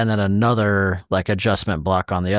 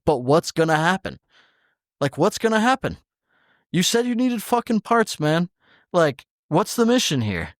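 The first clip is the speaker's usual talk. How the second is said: exasperated demand; 7 semitones higher than usual. Retold or imitated. Arguing with the boss over shims in the retelling, exasperated. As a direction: pitch climbing into high, pleading disbelief.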